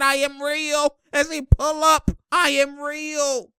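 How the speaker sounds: high, drawn-out mock boast; stretched high-pitched brag; Precious. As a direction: imitate a player boasting in a high voice. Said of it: "Precious said".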